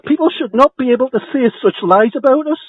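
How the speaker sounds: angry tenant shouting down the housing officer; indignant outburst. Real, not acted. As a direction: protest it loudly. Tenant protests the report.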